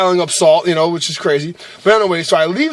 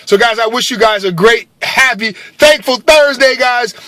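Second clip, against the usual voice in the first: excited sign-off; 6 semitones higher, 4 dB louder than usual. Real, not acted. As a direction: stretch out the cheerful farewell. Sign-off wish to viewers.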